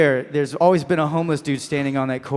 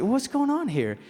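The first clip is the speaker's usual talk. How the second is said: high, softer baffled question; confused; 10 semitones higher, 5 dB quieter than usual. Retold or imitated. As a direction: retell your bewilderment in a raised pitch. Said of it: "I was, like, confused".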